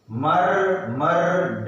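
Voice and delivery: drawn out, each part separate; spelled out by sound parts; slow syllable split. Shown as a demonstration. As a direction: break it apart slowly. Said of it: "अलग-अलग करते समय".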